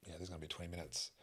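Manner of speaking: imitating an offhand doctor, low and quick; soft, hurried mock brush-off; dismissive doctor. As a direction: toss it off quietly and fast, like an indifferent doctor. Retold doctor brushing them off.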